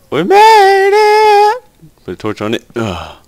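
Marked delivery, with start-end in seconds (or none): 0.1-1.6 high, stretched victory cry; triumphant shout. Real, not acted. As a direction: belt it out in relief. Triumphant shout after escape.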